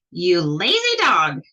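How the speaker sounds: loud, slow, furious witch shout. Acted. The witch shouts loud at the sleeping dog. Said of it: "shouted Bony Legs".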